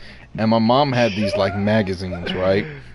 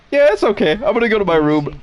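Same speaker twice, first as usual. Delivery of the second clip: little brother; 7 semitones higher, faster than usual. Retold or imitated. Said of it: "my little brother was like".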